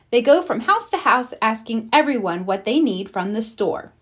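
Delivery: usual speaking voice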